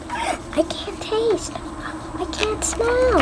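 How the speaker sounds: high, slow, drawn-out complaint; mock wail. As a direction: wail each phrase in exaggerated dismay. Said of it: "and you're like".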